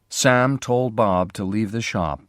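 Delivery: ordinary delivery, nothing marked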